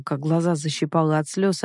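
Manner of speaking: ordinary delivery, nothing marked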